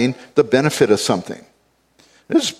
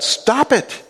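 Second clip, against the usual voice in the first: high, forceful stop it; emphatic command; 10 semitones higher than usual. Retold or imitated. Preacher voicing Paul's command to the Corinthians. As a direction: bark it like an order. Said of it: "that's what Paul said".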